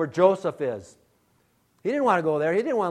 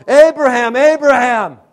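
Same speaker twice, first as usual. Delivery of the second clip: loud divine call, higher and louder; 7 semitones higher, 13 dB louder than usual. Acted. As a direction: call his name across the distance. God calling out to Abraham.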